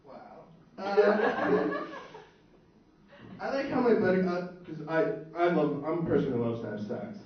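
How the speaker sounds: usual speaking voice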